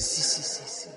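hushed, nervous string of yeses; very soft, jittery agreement. Retold or imitated. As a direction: imitate a nervous boy giving in quietly. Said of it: "se puso nervioso".

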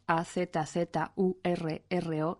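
ordinary talk, nothing marked

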